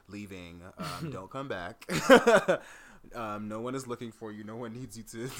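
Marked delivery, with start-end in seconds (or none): 1.9-3.1 big hearty laugh; loud burst of laughter. Real, not acted. Big laugh at own joke.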